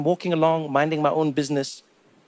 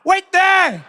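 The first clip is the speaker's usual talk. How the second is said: high, loud shout at a celebrity; mock order hollered at a star; imitated street holler; 14 semitones higher, 9 dB louder, much slower than usual. Imagined fan overseas hailing a celebrity, presumptuous shout. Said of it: "There's no one overseas who's like".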